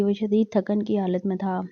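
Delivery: plain reading voice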